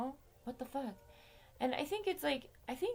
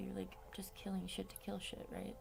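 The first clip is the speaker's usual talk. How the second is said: whispered aside; 7 dB quieter than usual. Real, not acted. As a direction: whisper it, unvoiced and hushed. Aside under breath.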